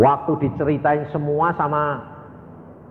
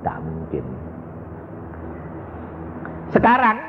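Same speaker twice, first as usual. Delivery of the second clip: ordinary talk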